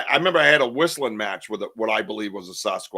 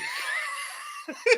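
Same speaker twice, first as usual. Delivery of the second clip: breathy laugh; 6 dB quieter than usual. Real, not acted. Laughing at tree knock story.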